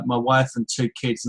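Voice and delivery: usual speaking voice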